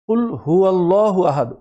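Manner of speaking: কুল হুয়াল্লাহু আহাদ with a round, full-mouthed llah; full-mouth Allah. Shown as a demonstration. Teacher shows the rounded heavy Allah in Surah Ikhlas. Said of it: "মুখ round হয়ে ফুল মাউথে উচ্চারণ হবে".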